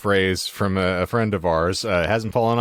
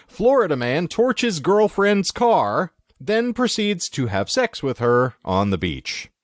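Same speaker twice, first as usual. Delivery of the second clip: slow, higher-pitched, drawn-out headline read; headline announcer; 9 semitones higher, slower than usual. Acted. Host reading co-hosts a Florida man headline, announcer style. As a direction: announce it word by word with relish.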